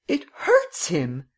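slow, drawn-out protest; acted, mildly pained insistence; distressed plea. A narrator's reading. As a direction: plead it slowly for the hurt horse. Miss Cummins pleads for the horse.

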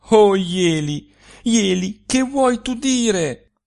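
high, loud, slow anxious cry of his name; anxious plea. A narrator's reading. Young woman frightened by her lover's hint, high anxious plea. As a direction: beg him to explain, frightened. Malia pleads with Jeli.

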